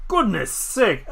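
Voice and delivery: put-on old man scolding, some groans; lightly voiced cranky elder. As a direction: grumble and scold the child. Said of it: "and says".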